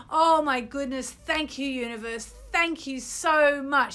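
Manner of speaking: over-the-top gratitude with gasps of delight; frenzy of happiness. Shown as a demonstration. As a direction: burst with giddy joy as if the wish came true. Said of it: "Okay, so here we go".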